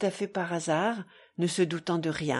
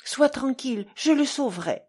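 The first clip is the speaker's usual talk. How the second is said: eager, higher and louder assurance; quick-to-answer, raised-voice promise; hastened reply; 5 semitones higher, 4 dB louder than usual. A narrator's reading. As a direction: jump in to reassure him. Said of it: "s'empressa de dire Katia".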